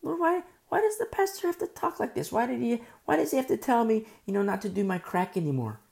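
high, whiny mock protest; mad complaint. Believer complains to the Lord about the pastor, high indignant whine. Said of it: "you get mad at your pastor".

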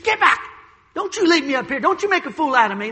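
sharp high yell that settles into fast pleading; angry outburst. Retold or imitated. Preacher voicing furious Saul stopping Samuel, high and loud. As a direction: snap back at Samuel in a temper. Saul lost his temper.

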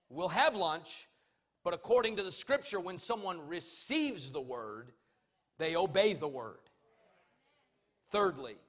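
loud, forceful pulpit delivery; preaching. Real, not acted. Preaches at full force to congregation.

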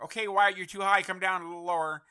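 loud, high worried call. Pretend. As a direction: call up to someone flying too high. Imagined worried call to the pilot.

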